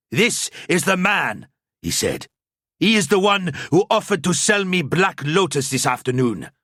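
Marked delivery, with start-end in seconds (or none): 0.1-1.5 put-on slow, higher, gruff Bretonnian-accented accuser. A narrator's reading. Grubheimer accusing the priest in a strong Bretonnian accent. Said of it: "his voice gruff, his accent strong". 2.8-6.5 put-on gruff Bretonnian accent. A narrator's reading. Grubheimer accusing the priest in a strong Bretonnian accent. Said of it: "his voice gruff, his accent strong".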